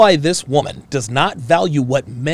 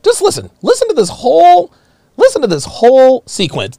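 emphatic louder call; 7 semitones higher, 5 dB louder than usual. Real, not acted. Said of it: "reframe this for you".

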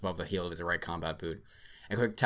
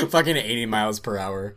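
laughing; 3 semitones higher, 8 dB louder than usual. Real, not acted. Laughing while repeating.

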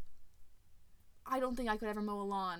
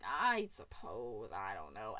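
much lower, slow, put-upon mumble; grudging king; 7 dB quieter, slower than usual. Retold or imitated. King Brian grudgingly gives in, low and slow. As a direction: drag it out low like a reluctant old king.

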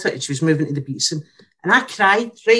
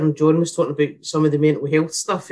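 ordinary talk, nothing marked

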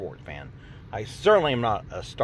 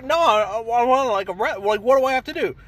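higher, flustered stammering user; mock panicked questioner, pitched higher; 9 semitones higher, 4 dB louder than usual. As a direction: fumble for words like a lost user.

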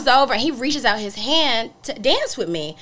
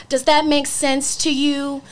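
slow, higher-pitched patronizing question; condescending; 4 semitones higher, much slower than usual. Retold or imitated. Imitating a date explaining Airbnb, slow condescension. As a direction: mimic someone talking down to you. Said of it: "in a really condescending way".

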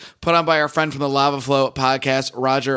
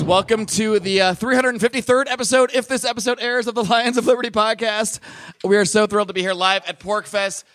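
pitched-up, upbeat host welcome; host hyping up the live crowd, a bit higher; live show opener; 7 semitones higher than usual. Acted. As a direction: kick off the live episode with energy.

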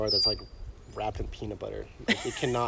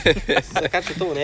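hammed-up Canadian accent, slow and louder; mock Canadian accent; 6 semitones higher, 4 dB louder than usual. Friend keeps joking around in a Canadian accent. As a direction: stretch it out with a Canadian eh. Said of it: "That is Canadian, eh?".

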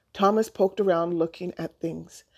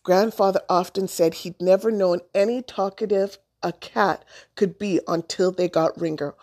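plain reading voice

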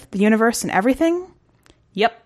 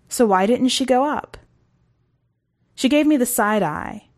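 usual speaking voice

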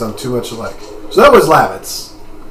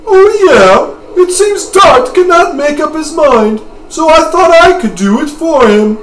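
high, loud British Haschel voice; British accent; 14 semitones higher, 7 dB louder than usual. Acted. Haschel stepping in because Dart can't decide, high British accent. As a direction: put on a very high British accent, pushed louder. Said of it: "Haschel is now my British accent".

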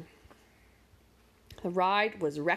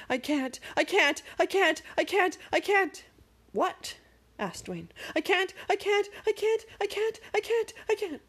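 higher and higher frightened chant; panicked muttering; 9 semitones higher than usual. A narrator's reading. Magic terrified on the speeding sled, rising panicked chant. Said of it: "It wasn't loud at first".

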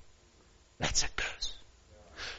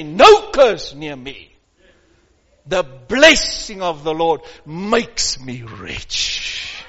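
bold declaration; 5 semitones lower, 5 dB louder, slower than usual. Shown as a demonstration. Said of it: "And I say".

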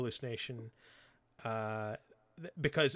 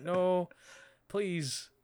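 slightly raised, imitated plea; mock pleading; 4 semitones higher than usual. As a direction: act out a hotel owner begging the intruders. Hotel guy begs invading vampires.

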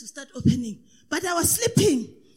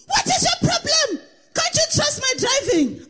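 husband snapping, pitched much higher; annoyed husband; 11 semitones higher than usual. Retold or imitated. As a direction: play the irritated husband at the wheel. Husband's angry retort retold.

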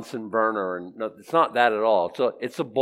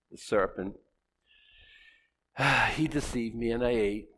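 much softer, sighing the serpent, he deceived me; soft confession; 2 semitones lower, 7 dB quieter than usual. Retold or imitated. Eve owning her sin, soft and sighing. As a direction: admit it quietly with a sigh. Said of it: "tone is everything".

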